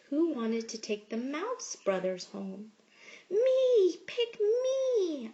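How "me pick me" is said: high, loud eager shout. A narrator's reading. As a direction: yell it out, pleading to be picked. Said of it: "I shouted".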